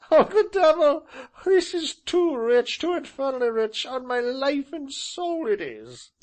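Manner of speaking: high, loud, slowed laughing glee; gleeful delight. Acted. Gentleman hugging himself with delight. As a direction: revel in the joke. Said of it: "hugging himself with delight".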